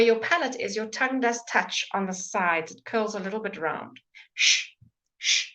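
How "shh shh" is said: long hissed shh through the front teeth; teacher's model shh; demo sh sound. Shown as a demonstration. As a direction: push air out in a steady shh. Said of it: "you're pushing a little bit of air".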